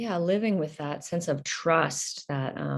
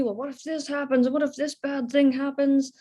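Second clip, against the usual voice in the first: higher, worried dad voice; fear-mongering dad; 8 semitones higher than usual. Retold or imitated. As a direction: mimic dad's anxious what-ifs. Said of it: "my dad fear mongering me".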